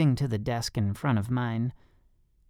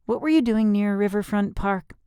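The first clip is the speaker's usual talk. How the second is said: put-on girl voice; 8 semitones higher, 3 dB louder than usual. A narrator's reading. Girl's voice.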